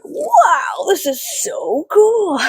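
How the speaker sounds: squealed high, slow gushing wow; mock gushing wow. Reenacting her unboxing moment, squealing high and slow. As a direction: overdo the delighted unboxer. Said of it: "and I'm like".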